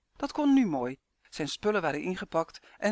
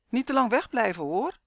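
raised, louder parting call; 6 semitones higher, 5 dB louder than usual. A narrator's reading. Mother calling to son at the front door, calling out. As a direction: call after someone who is leaving. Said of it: "riep zijn moeder".

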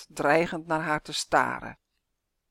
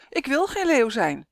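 calling out; 6 semitones higher, 3 dB louder than usual. Acted. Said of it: "roept ze".

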